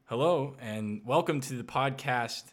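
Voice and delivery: show host intro. Acted. Opening the podcast show.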